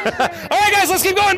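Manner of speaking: ordinary delivery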